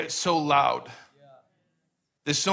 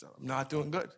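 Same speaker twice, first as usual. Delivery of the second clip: much softer, near-tearful murmur; tearful admission; 4 semitones lower, 10 dB quieter than usual. Retold or imitated. Friend at the grocery store admits he's struggling, softly. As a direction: let it drop away, close to tears. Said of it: "He's just".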